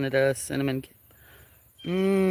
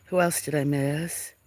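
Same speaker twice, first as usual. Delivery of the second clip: usual speaking voice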